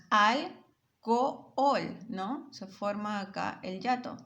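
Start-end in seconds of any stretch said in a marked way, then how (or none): 0.1-2.0 alcohol drawn out slowly as al-co-hol, each vowel apart; slow hiatus. Shown as a demonstration. Teacher showing the class how alcohol separates. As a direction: stretch alcohol so the two o's split.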